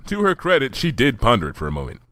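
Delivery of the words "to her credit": usual speaking voice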